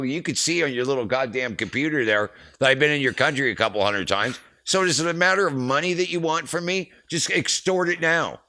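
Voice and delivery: high, loud indignant rant; fired up. Retold or imitated. Roadie accusing the border cop of wanting money, fired up. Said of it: "get all fired up".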